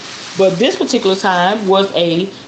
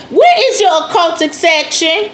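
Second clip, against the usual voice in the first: bold shopper; 9 semitones higher than usual. Retold or imitated. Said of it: "Now I be like".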